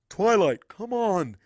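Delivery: muffled through door. Acted. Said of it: "comes his muffled voice to the door".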